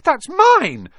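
very high, loud cry of ownership; indignant protest. A narrator's reading. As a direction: yell in outrage at the snatcher. Boy protests as letter is snatched.

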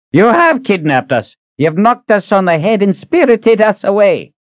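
put-on high, indignant old man's voice; accusing old man. Old Reuben accusing Ahern of kidnapping, high and indignant. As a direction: accuse him with wounded outrage.

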